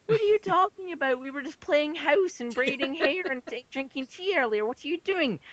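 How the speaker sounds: very high squeaky doll voice, bewildered; a doll protesting in a tiny high voice; confused doll. Retold or imitated. Doll asking her owner why she must fight, squeaky.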